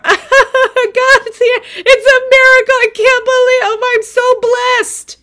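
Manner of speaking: overjoyed at the miracle, cry-laughing in the car; crazy cry laughing. Retold or imitated. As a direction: laugh and sob at once in disbelief. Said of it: "crazy cry laughing".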